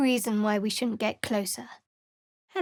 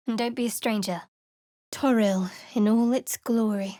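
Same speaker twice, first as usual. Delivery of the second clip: usual speaking voice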